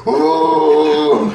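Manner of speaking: mock startled squeal; startled ooh. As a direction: yelp like balls just hit your face. Retold friend's startled cry.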